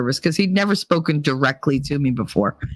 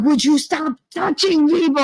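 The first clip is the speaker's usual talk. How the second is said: husband's complaint; 10 semitones higher than usual. Retold or imitated. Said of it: "He was like".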